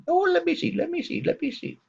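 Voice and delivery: high, strained put-on voice of the doctor; mock doctor voice. The doctor greeting the patient in a high mock voice. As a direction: squeeze the voice up high to play him. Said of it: "and he goes".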